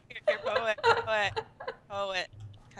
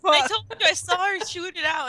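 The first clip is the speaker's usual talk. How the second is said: ordinary talk, nothing marked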